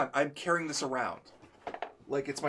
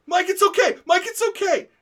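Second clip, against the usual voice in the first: mock consoling; 12 dB louder than usual. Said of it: "And they're like".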